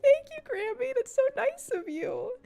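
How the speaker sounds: put-on high, sweet, childlike thanks; high small-child voice; sweet little girl. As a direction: sound like a grateful little kid.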